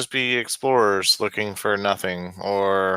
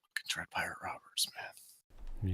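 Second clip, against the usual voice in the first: whispered, far softer than usual talk; muttered aside; 2 semitones lower, 15 dB quieter than usual. Real, not acted. Player making a wry aside about Dread Pirate Roberts, muttered. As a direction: say it under your breath.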